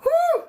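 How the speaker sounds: a very high, loud whew of excitement; excited whew. Real, not acted. Preacher overexcited before her viewers, very high whew. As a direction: blow it out, overexcited. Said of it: "Let me calm down".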